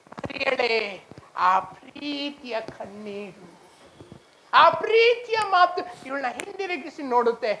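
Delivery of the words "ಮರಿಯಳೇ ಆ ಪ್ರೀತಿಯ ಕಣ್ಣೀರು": slow, soft, low call; hushed, drawn-out tenderness; tender. Acted. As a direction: draw the words out lovingly and low. Lord's loving call to Mary.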